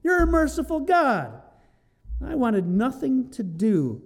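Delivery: a sharp, high accusing start, then settling; angry Jonah. Retold or imitated. Jonah resenting God's mercy, angry. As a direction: throw it at God as an accusation. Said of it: "He's so angry".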